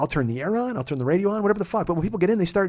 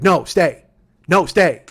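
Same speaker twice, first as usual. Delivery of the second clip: urgent insistence; 7 dB louder, much slower than usual. Retold or imitated. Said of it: "He's like".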